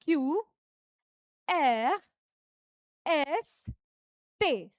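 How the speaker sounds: French letters said crisply one by one; teacher repeating French letters; French letter names. Shown as a demonstration. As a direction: model each French letter for students. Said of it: "फिर से repeat करेंगे".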